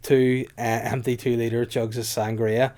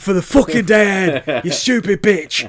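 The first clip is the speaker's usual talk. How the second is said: high, loud, slow epic fury; Game of Thrones rage; 8 semitones higher, 9 dB louder than usual. Pretend. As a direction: roar it like a savage lord. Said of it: "I turn into Game of Thrones".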